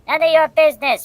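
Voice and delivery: high, loud mock retort; mock shout. Russian bears brushing off the Canadians, mock shout. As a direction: snap it back up high, cheeky. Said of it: "polar bears say".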